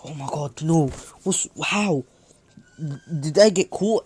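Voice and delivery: mild inner panic, rising at the end; panicking inwardly. Acted. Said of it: "he's kind of panicking in his head".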